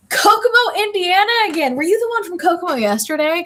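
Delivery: light up at the town name; excited. Real, not acted.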